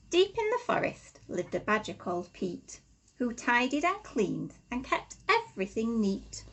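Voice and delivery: lively, rhythmic storybook reading; reading to children. Acted. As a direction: read the rhymes aloud to little ones, bouncing along. Reads a rhyming picture book aloud.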